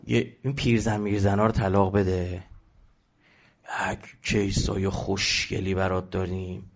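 low, slow sleazy offer, put-on voice; insolent men. Men urging the Prophet to swap his old wife, low sleazy sneer. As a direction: sneer it like a shameless matchmaker. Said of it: "خیلی وقیح بودن".

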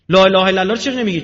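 fast, loud, high demanding question; loud challenge. Acted. Preacher questioning the congregation, fast loud challenge. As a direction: fire the question at them.

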